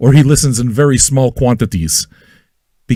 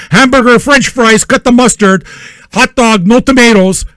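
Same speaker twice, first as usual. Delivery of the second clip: higher, raised kitchen order call; 7 semitones higher, 3 dB louder than usual. Acted. Line cook to the kitchen during lunch rush, calling out orders. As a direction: fire off each order higher and forcefully.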